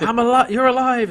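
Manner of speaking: mock-thrilled gasp of relief; joyful disbelief. Chiana overjoyed seeing Crichton.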